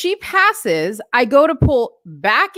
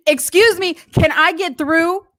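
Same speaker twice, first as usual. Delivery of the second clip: higher, pointed "excuse me, can I get through"; imitating the older lady; 6 semitones higher than usual. Imitating the scooter lady asking to get past the car, pitched-up and pointed. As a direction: mimic her pushy polite request, pitched up. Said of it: "that's exactly how she said it".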